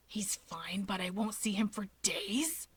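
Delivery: hushed, breathy worried whisper; whispered Shigaraki. A narrator's reading. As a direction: whisper it, anxious and clingy.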